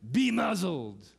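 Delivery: stern muzzling order; forceful command. Retold or imitated. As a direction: silence a violent empire. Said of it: "saying to a violent Roman Empire".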